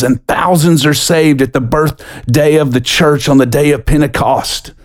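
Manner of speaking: normal talking voice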